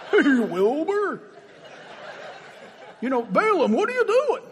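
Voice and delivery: a goofy TV horse teasing his owner, chuckling; Mister Ed voice. Retold or imitated. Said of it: "like Mister Ed".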